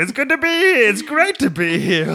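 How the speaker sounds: high, strangled hammed-up meltdown voice; mock meltdown. MC breaking down on stage, high strangled squeak. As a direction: crack under pressure and squeak it out. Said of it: "I was like".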